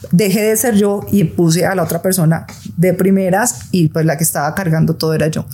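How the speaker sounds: ordinary talk, nothing marked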